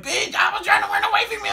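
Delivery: high, loud, fast yelled retort; startled shout. Retold or imitated. Shouting at Porcupine who grabbed her shoulder. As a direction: blurt it out in panic. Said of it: "I'm like".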